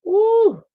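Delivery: shrill high squealed ooh; a long high dramatic ooh; loud falsetto ooh. Acted.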